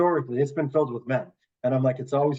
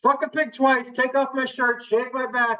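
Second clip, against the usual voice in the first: much higher, rattled-off short-order cook callout; diner order callout; 11 semitones higher than usual. Retold or imitated. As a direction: sing out the order in diner slang. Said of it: "I just want somebody to be like".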